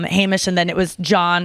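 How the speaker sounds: ordinary delivery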